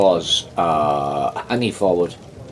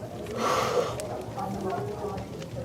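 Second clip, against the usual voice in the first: long blissful sigh, starting high and much softer; mock contented sigh of pleasure; savoring sigh; 13 semitones higher, 11 dB quieter than usual. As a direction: sigh like tasting something divine. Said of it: "and he goes".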